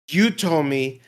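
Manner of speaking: usual speaking voice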